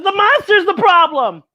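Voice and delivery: put-on high, worried child's voice; little girl voice. Young Buffy telling the librarian about the monster, high and worried. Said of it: "And she says".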